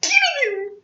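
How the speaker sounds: put-on very high, slow mother with Croatian accent; screechy imitation of a Croatian mother. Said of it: "she'd say in her Croatian accent".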